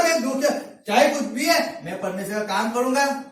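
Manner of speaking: ordinary delivery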